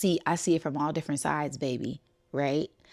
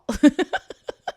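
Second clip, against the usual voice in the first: high loud burst of laughter; sudden high cackle; 10 semitones higher, 9 dB louder than usual. Real, not acted. Laughing at son's blame.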